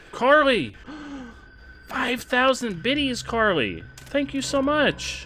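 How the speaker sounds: high, amazed burst of thanks; thrilled shout-out. Real, not acted. Streamer thanking viewer for big donation, thrilled.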